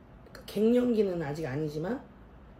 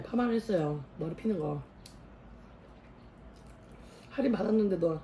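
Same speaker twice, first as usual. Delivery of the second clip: talking between bites; chewing while speaking; mouth full of food; 4 dB quieter than usual. Real, not acted.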